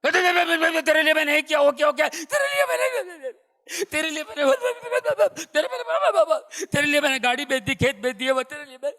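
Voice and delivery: high-pitched, rambling guilt-trip rant, words tumbling and repeated; shrill-pitched outburst listing your sacrifices, building to a shout; parent's scolding tirade. Acted. Parent reminding grown children of all they did, high scolding rant. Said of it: "आपने उनको सुनाना शुरू कर दिया".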